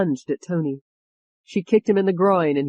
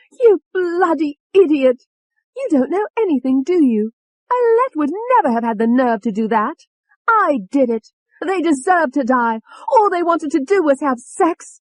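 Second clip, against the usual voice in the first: high, loud furious tirade; piercingly raised, contemptuous outburst; enraged confession; 11 semitones higher, 6 dB louder than usual. A narrator's reading. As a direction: boast of it with vicious rage. Enraged alter confesses to murders.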